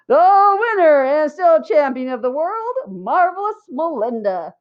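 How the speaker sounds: loud, high ring announcer; bellowed. A narrator's reading. Lori announcing Melinda champion, bellowing ring announcer. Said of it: "she bellowed".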